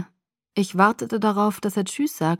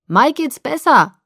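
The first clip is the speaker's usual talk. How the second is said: loud, higher, drawn-out call; 7 semitones higher, 7 dB louder, slower than usual. A narrator's reading. Father calls the news up to the daughter, calling out. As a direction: call it up to someone upstairs. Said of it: "rief er zu mir herauf".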